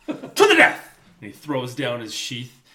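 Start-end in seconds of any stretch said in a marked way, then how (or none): 0.1-1.0 high, loud hammed-up battle cry; mock challenge. Friend reenacting Prince Humperdinck's duel call, as a loud hammed-up cry. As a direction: bellow it like a pompous prince. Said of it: "he says".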